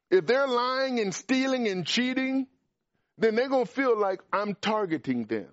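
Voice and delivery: fiery preacher hammering home the point; preaching at full force. Acted. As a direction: build the sermon to a peak.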